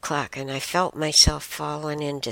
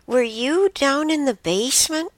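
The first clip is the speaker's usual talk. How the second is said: much higher, lightly voiced elderly lady; confused old lady; 13 semitones higher than usual. Deaf roommate asks her about the basement, higher mock old-lady voice. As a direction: ask it like a puzzled old roommate. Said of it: "she looked at me and said".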